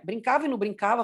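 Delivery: normal talking voice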